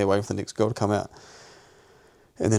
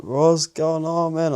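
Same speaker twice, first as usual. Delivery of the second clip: drugged slur; 6 semitones higher, much slower than usual. Retold or imitated. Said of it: "He's like".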